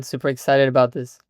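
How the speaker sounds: ordinary delivery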